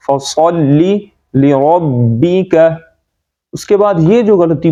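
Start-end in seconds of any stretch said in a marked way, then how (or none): 0.1-2.5 "فصلی لربک" slow with thick s and r; heavy saad and r. Shown as a demonstration. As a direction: round out "فصلی لربک" syllable by syllable. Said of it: "صاد کو بھی موٹا".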